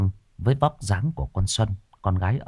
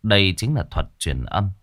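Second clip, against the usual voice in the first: ordinary delivery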